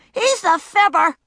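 put-on high, loud child's yell. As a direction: shout the blame. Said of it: "she yelled".

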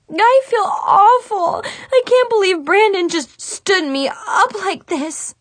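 high, loud, slow tearful wail; bursting into tears. A narrator's reading. Heartbroken girl to her friends, wailing through tears. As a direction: sob it out, heartbroken. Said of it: "burst into tears".

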